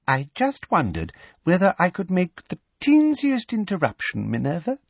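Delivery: high, sickly-sweet simper; sugary sweet voice. Acted. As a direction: coo it falsely sweet.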